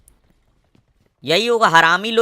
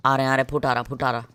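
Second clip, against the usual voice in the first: ordinary talk, nothing marked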